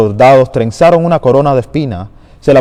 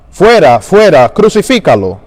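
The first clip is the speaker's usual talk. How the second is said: loud, high, furious cry; crowd shouting; 5 semitones higher, 4 dB louder than usual. Acted. Crowd to Pilate, rejecting their king, loud high shout. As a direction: hurl it out as an enraged mob. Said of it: "Ellos gritaron".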